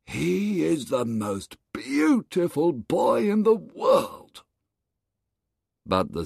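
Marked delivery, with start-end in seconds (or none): 0.1-4.4 higher, awed voice; admiring crowd. Acted. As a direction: marvel at the boy. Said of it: "They all said".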